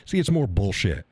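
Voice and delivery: ordinary talk, nothing marked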